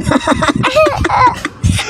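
squealing laugh; high laugh. Real, not acted. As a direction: crack up at it. Laughing at sour lemon. (matinis na tawa)

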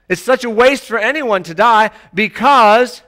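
normal talking voice

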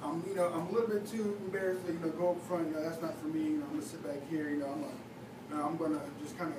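low, subdued excuse-making; embarrassed churchgoer. Acted. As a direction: mumble low and soft, begging off. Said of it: "we'll go to church and we'll say".

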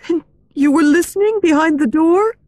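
very high, disbelieving heroine voice; shocked question. A narrator's reading. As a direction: realise it with alarm, pitched high. Christine shocked at eavesdropping.

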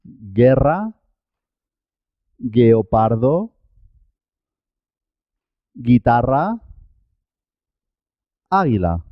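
hard G and no U sound in "Guerra, guepardo, guitarra, águila"; "guepardo, guitarra" as gheh-PAR-do, ghee-TA-rra, a teacher's model; silent U after G. Shown as a demonstration. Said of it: "La U es muda en este caso".

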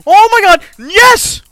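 high, loud shouts; triumph turning to despair. Real, not acted. Gamer makes it then fails, shouted despair. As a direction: yell in glee, then howl the loss. Success turning to sudden failure.

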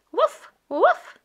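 high, loud puppy woof; puppy bark. Acted. Voicing the puppy Biscuit barking.

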